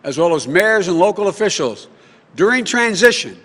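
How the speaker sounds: loud, rousing campaign rally speech. Acted.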